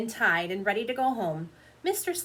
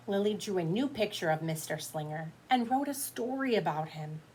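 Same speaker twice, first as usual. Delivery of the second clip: ordinary delivery, nothing marked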